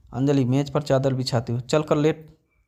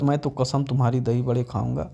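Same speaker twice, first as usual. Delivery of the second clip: usual speaking voice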